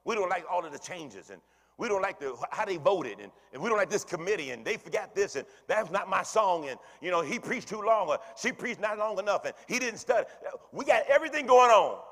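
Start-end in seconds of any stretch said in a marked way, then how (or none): 5.7-12.0 rising rant. Acted. Preacher listing church gripes to his flock, building to a shout. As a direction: climb higher and louder to a shout. Preacher mocking congregation complaints.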